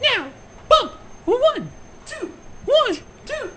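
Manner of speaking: high, gushing fitness-guru voice; aerobics instructor. Pretend. Playing Rarity as Richard Simmons, gushing aerobics host. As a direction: hype the class like Richard Simmons. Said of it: "I'm Rarity Simmons".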